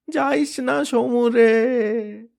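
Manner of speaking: high, very slow drawn-out wail; a mother begging her son to stay; stretched crying plea. Acted.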